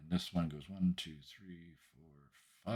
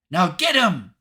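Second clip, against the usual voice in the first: tiny cheerleader's high, loud cheer; Hope; 11 semitones higher, 11 dB louder than usual. Acted. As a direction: squeal it like a pint-sized cheerleader. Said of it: "it's the little cheerleader outfit again".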